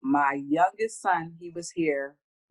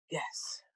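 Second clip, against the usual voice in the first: gasp; 10 dB quieter than usual. Real, not acted.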